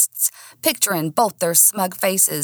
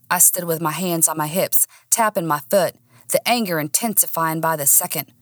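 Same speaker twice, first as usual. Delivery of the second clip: plain reading voice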